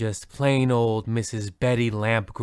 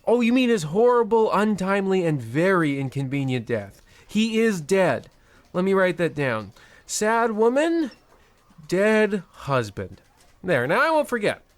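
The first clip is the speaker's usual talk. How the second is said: higher, brisk, callous banker; smug officious banker, pitched up; bank manager; 6 semitones higher than usual. Acted. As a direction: rattle off the cruelty like routine paperwork.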